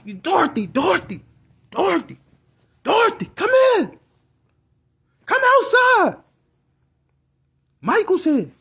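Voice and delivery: high-pitched, mounting holler; calling out. Retold or imitated. Dad calling mom from the garage, excited holler. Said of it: "he started calling my moms from the garage".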